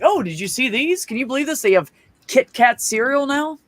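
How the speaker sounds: high-pitched, overeager chatter; mock amazed small talk. Shopper filling an awkward moment with a stranger, overexcited. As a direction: gush over a trivial find to fill silence.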